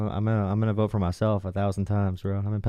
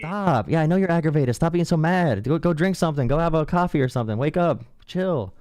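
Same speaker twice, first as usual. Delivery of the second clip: scolding; 5 semitones higher than usual. Real, not acted. Scolding him to calm down.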